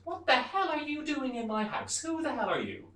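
high, loud acted outrage; outraged wife. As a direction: shriek at an intruder in mock fury. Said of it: "she goes".

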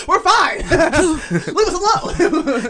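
squeaky laughing brush-off, leave us alone; high mock shooing. Couple on a date shooing off a pushy waiter, high and laughing. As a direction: shoo the waiter away in a high, giggly voice.

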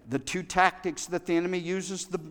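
ordinary delivery

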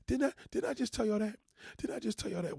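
worked-up reminder to viewers; incredulous. Real, not acted. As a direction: pitch it up in disbelief. Emphatic rhetorical question.

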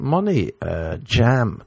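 ordinary delivery

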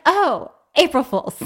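high, loud mock-breezy mom; prank reveal. Mom springs April Fool's on her child, gleeful.

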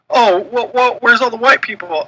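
high-pitched mock outraged fan; mock complainer. Mocking newcomer fans who come out of nowhere, high mock complaint. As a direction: mimic a clueless complainer, pitched well up. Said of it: "you got those people, like you said".

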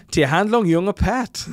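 loud, high, slow German; mock German accent. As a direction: belt out the translation in hammed-up German. Reading out German translation.

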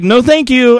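passerby waving off a man on a bench, high and loud; curt brush-off. Retold or imitated. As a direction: fire off a dismissive no thanks. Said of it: "I'm just like".

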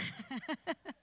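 soft, light chuckle; amused laugh. Real, not acted. Speaker jokes with the congregation mid-testimony, soft amused laugh. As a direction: laugh quietly at your own aside.

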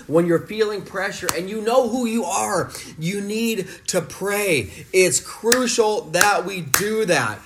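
high, loud preacher's cry; full-force preaching. Acted. Dad preaching to his kids about prayer, loud and high.

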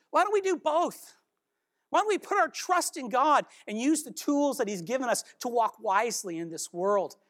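ordinary talk, nothing marked

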